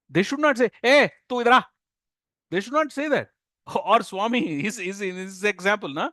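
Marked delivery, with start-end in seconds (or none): 0.8-1.6 loud, high, brusque call; rude summons. Acted. Stranger summoning someone without respect, loud and rude. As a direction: call someone over rudely and loudly.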